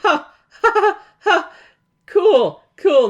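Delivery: high loud fake ha-ha and cool; mock nervous laugh. Host mocking the heroes' dread, high forced laugh.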